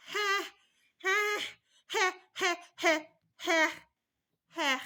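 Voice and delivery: high he cries, each with a breathy H sound before. Shown as a demonstration. Mother showing mothers the uncomfortable baby cry, high breathy he. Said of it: "there's a H sound before".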